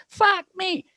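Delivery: high, hammed-up, excited agreement; imitating Phil. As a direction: squeak it out like the player. Phil voiced in retold story.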